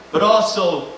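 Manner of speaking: ordinary delivery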